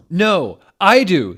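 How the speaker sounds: high, loud insistent shout. Acted. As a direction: yell it back at him.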